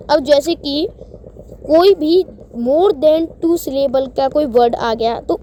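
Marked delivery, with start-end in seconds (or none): none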